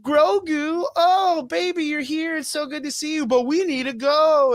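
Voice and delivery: put-on very high tearful baby talk; mock doting dad. Fan mocking Mando's reunion with Grogu, high mock-tearful cooing. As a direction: coo over the child like a sappy parent. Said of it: "And he's like".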